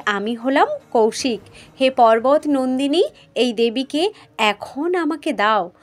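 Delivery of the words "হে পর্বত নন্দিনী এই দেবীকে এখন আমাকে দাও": ordinary delivery, nothing marked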